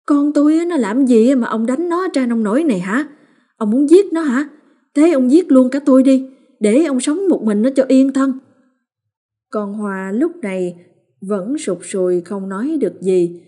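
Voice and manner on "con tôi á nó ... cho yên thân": high, loud shrieking rant at the husband; furious scolding. A narrator's reading. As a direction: scream it at him like a furious mother. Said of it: "chửi như tát nước vào mặt". (mắng chồng xối xả, giận dữ)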